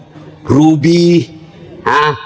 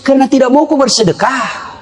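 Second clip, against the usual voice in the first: ordinary talk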